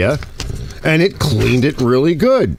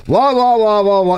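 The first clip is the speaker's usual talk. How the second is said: mock droning gibberish; 9 semitones higher than usual. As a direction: parody yourself as pure noise to the listener. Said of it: "Arch going".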